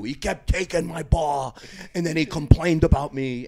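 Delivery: lightly voiced Jamaican lifter complaining slowly; Jamaican accent. The Jamaican training partner complaining to Larry, a hint of a Jamaican accent. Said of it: "And he's Jamaican, you know, with that accent".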